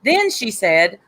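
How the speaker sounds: usual speaking voice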